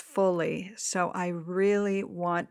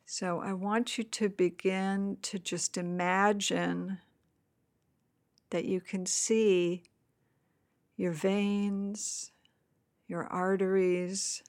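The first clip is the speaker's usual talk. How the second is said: guided meditation. Acted. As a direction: gently guide them into the body.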